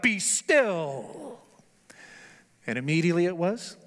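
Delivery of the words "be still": commanding cry. Retold or imitated. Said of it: "simply cries out".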